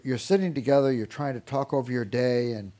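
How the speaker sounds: usual speaking voice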